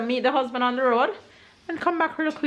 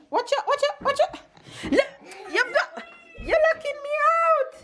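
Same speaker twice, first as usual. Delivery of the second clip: high, laughing squeal of alarm; playful squeal. Real, not acted. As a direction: squeal as the door shuts on you. Kid locks her out, playful.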